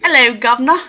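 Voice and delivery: loud, cheeky mock-Cockney greeting; bad British accent. Host clowning for viewers, badly faked British accent. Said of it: "I'm really bad with accents".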